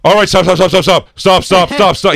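high, loud urgent stop stop; serious pleading. Retold or imitated. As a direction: beg her to stop, dead serious now. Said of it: "He gets so serious".

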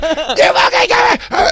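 very high, drawn-out mock screech; mock enraged screech. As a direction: imitate an outraged officer shrieking. Imitates enraged lady officer screaming.

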